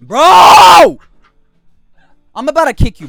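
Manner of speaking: angry yell; shouting. Real, not acted. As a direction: bark it out loud. Yelling at disruptive friend.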